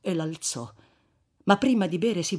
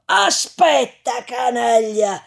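higher, louder, slow threatening bellow; threatening shout; 6 semitones higher, 8 dB louder, slower than usual. Acted. Corpse-carrier scaring off the mob, threatening shout. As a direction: scare them off with the threat.

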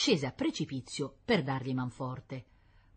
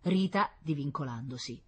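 ordinary delivery, nothing marked

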